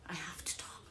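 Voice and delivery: breathy whispered plea; a hushed, urgent whisper. Real, not acted. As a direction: whisper it, barely voiced, insisting. Pleading to be let speak.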